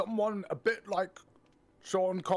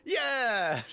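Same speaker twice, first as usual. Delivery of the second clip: excited; 12 semitones higher, 5 dB louder than usual. Pretend. Song shout ending.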